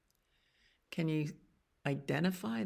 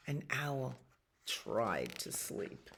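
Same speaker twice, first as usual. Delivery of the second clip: sleepy and quiet; 5 semitones lower, 10 dB quieter than usual. Acted. Repeated sleepy refrain.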